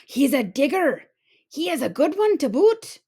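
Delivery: hearty pirate call to the crew; pirate yelling. A narrator's reading. Pirate calls to his crew about the boy, hearty shout. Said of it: "yelled back to his crew".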